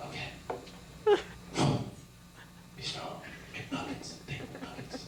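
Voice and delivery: whispering nervous pep talk; whispered self-talk. Pretend. Comic whispering to himself before introducing himself. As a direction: hype yourself up under your breath. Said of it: "my next impression is".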